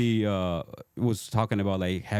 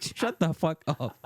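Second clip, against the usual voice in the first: mock exasperation; 6 semitones higher, slower than usual. Laughing dismissal of Hyderabad as Ohio.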